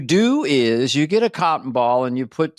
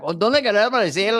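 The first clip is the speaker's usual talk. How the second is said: higher, fast Mexican Spanish voice; Mexican accent; 6 semitones higher, faster than usual. Retold or imitated. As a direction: rattle it off like a Mexican ant. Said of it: "the ants from Mexico are like".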